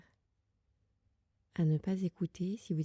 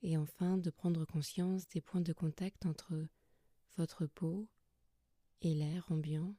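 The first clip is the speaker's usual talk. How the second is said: soft, calm yoga nidra guidance with long pauses; guided meditation; 5 dB quieter than usual. Acted. Yoga teacher settling listeners lying under blankets, soft guided meditation. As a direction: guide them gently into rest, leaving long silences between phrases.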